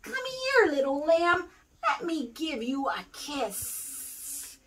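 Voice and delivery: slow, sly snake hiss. A narrator's reading. Snake hisses sweetly to lure the little lamb. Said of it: "He started to hiss".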